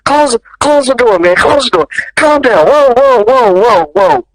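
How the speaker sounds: high, repetitive, panicky calming; imitated alarmed halting. Director tries to stop the improv fight, alarmed. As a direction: act out frantically calling off a fight.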